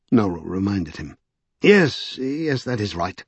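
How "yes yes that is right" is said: high first word, falling away impatiently; irritable. A narrator's reading. Strange reminded of his real purpose, irritable. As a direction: brush off the reminder testily. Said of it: "said Strange irritably".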